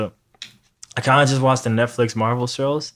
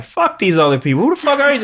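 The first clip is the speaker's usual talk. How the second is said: mock outrage; 10 semitones higher than usual. Ranting at a new villain.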